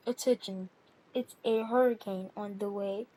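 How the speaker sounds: low, measured hurricane warning; mock announcement.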